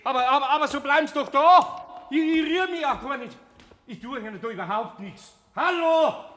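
high, loud, frantic protest; desperate plea as the door shuts; panicked pleading. Acted. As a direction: beg him not to lock the door. Panicking as he is locked in.

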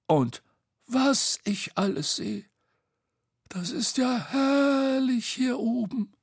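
high, marvelling character voice; Bo, delighted. Acted. Bo admiring the view from up high, delighted. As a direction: gush over the view in a high voice.

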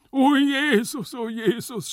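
high, drawn-out cry; loud, high stage wail; anguished scream. Acted. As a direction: cry out in melodramatic agony. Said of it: "schrie der Marchese noch immer".

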